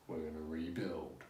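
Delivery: low, quiet movie-hero delivery; mock movie line. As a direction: drop low and soft for a mock-solemn film quote. Mimics the film's closing line.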